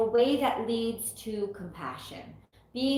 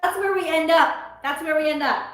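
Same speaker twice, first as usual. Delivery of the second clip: higher, louder delighted words; excited; 5 semitones higher, 6 dB louder than usual. Real, not acted. Reader delighted by the card's meaning. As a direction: announce it happily.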